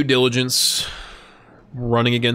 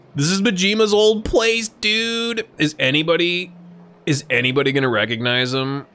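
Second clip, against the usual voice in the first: ordinary talk, nothing marked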